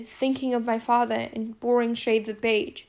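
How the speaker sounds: usual speaking voice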